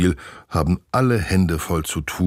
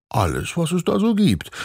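put-on shrugging boy; 13 semitones higher than usual. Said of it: "Olaf zuckt mit den Achseln".

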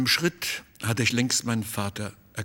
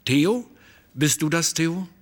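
higher, louder call toward someone unseen; questioning call; 6 semitones higher, 4 dB louder than usual. A narrator's reading. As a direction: call out as if checking who is there. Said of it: "Dann rief er". (fragendes Rufen)

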